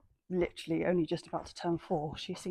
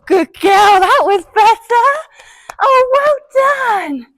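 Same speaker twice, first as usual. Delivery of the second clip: high, loud, delighted praise drawn out slowly; overjoyed slow well done; gushing praise; 13 semitones higher, 19 dB louder, much slower than usual. Real, not acted. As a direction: melt over the pony's good jump. Praising pony, better attempt.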